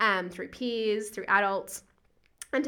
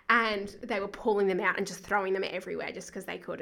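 ordinary talk

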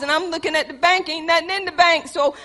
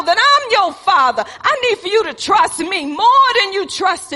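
loud preaching that builds to crying shouts; preaching at full force; 3 semitones higher, 4 dB louder than usual. Acted. Preacher voicing God to Daniel, building to a tearful shout. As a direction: preach it with rising fervour, voice cracking.

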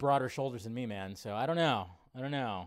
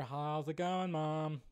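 ordinary talk

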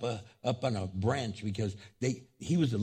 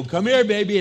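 put-on loud, higher call to a child; mama calling; 8 semitones higher, 10 dB louder than usual. Mama calls her fallen child over, loud and high. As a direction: call your baby over urgently.

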